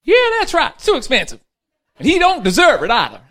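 high, loud, smug agreement; wife agreeing with the pilot, high and loud; stingy wife. Acted. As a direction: back him up as the tight-fisted wife. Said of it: "you think ten dollars is too expensive".

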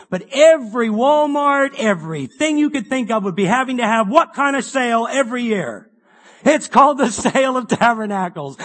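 loud, high, driving preacher voice; full-force preaching. Acted. Preacher urging the congregation to keep Tabernacles, loud and fervent. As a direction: preach it out to the hall with rising fire.

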